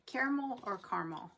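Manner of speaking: first "caramel" as CARE-uh-mul, second "caramel" as CAR-mul; both pronunciations. Shown as a demonstration. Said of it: "We know what you're saying".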